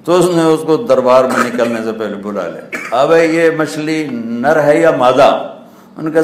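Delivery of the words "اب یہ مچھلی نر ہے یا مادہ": higher, louder, slow royal question; king's slow question. Acted. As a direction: ask it with mock gravity. King questioning fisherman. (بادشاہ کا آہستہ سوال)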